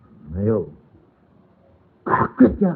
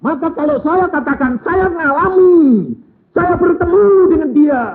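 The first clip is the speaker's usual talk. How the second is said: high, loud, stretched preaching cry; loud pulpit declaration; full-force preaching; 10 dB louder than usual. Acted. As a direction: proclaim it to the whole church.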